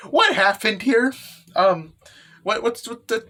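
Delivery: high, stammering disbelief; bewildered. Acted. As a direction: act shocked, tripping over the question. Character finds the broken machine.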